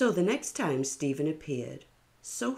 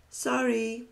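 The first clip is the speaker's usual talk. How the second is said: higher, breezy sorry; airy refusal; 8 semitones higher than usual. A narrator's reading. Sophie turning Stephen away, higher breezy sorry. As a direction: brush him off lightly. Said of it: "said Sophie".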